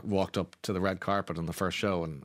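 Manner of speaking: usual speaking voice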